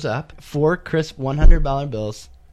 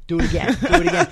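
retold yelling; 10 semitones higher, faster than usual. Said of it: "yelling at him to type in his pin again".

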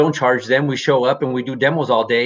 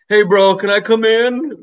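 higher, slow, pleading bro voice; drawn-out, higher pleading bro; tired bro asking; 7 semitones higher, 4 dB louder than usual. Retold or imitated. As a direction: plead to be let back inside. Worn-out owner begging to come inside.

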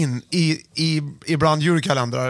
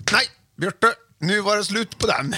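higher-pitched, brisk self-interruption; mock dismissal; 6 semitones higher than usual. Host imitating himself cutting off a sentimental moment, mock dismissal. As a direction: act out calling time on it. Acts out snapping out of it.

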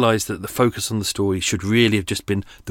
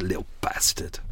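whispered curse; 3 semitones lower, 8 dB quieter than usual. Real, not acted. Muttering at the bird.

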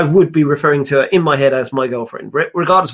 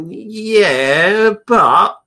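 reluctant hedging; 4 semitones higher than usual. Acted. Acts out a reluctant answer.

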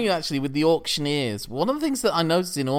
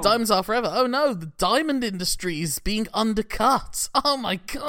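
panicking rich man; 9 semitones higher than usual. Retold or imitated. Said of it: "it's like".

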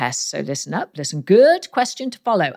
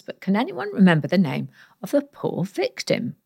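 ordinary talk

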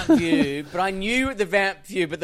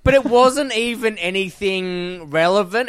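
ordinary talk

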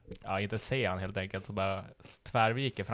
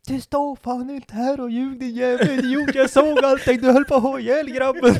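much higher, slow, louder crone scolding; an old woman bystander yells at the lying taxi driver; old hag yelling; 11 dB louder than usual. Retold or imitated. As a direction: screech like an angry old hag. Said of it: "Kom en gamkärring och bara".

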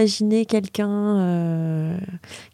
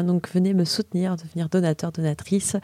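ordinary delivery

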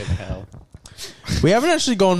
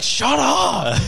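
much higher shut up; sleepy mate snapping, much higher; mock annoyed snap; 10 semitones higher than usual. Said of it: "he's like".

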